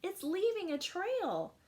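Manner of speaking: put-on children calling to the teacher; excited children. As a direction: point it out to everyone. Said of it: "say the children".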